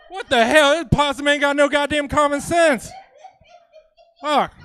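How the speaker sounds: loud, high Southern outburst; twangy Southern drawl. Acted. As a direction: holler indignantly in a thick Southern twang. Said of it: "like, a twangy drawl".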